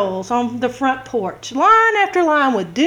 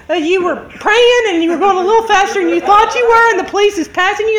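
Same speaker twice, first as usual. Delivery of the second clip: normal talking voice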